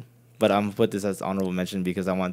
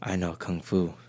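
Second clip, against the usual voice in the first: Matrix movie quote; 5 semitones lower, 6 dB quieter than usual. Retold or imitated. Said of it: "I always quote the".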